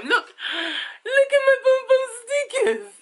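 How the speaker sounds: put-on gasp, then very high baby voice; playful child voice. Parent speaking for a child showing stickers, playful baby voice. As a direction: squeak it like a delighted toddler.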